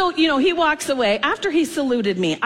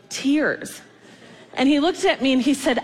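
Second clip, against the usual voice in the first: ordinary talk, nothing marked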